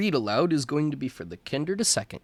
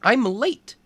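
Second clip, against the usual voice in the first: rabbit fretting; 7 semitones higher, 3 dB louder than usual. Acted. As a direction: tell her, worried about the time, a bit higher. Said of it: "he told Alice again".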